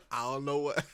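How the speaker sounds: ordinary delivery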